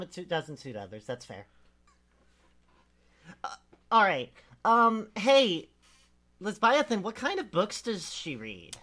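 ordinary talk, nothing marked

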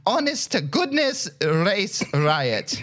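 halting, hammed-up Irish brogue; pseudo-Irish accent. Podcaster reading a listener's question as written, mock Irish. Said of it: "pseudo-Irish speech".

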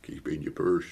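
low, soft Lancashire; Lancashire accent. Acted. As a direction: slip into Lancashire. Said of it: "Oh, it's Lancashire".

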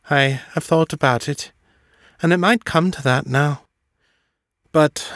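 a quick, lilting woman's voice, kindly; Esme's character voice. A narrator's reading. Esme asking John about adopting, quick lilting voice. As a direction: voice Esme, brisk and kind.

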